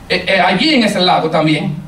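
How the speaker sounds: ordinary talk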